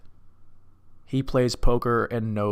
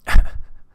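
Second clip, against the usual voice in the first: short, loud burst of laughter; amused laugh; 12 dB louder than usual. Real, not acted. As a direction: crack up at your own dumb joke. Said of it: "It's so dumb".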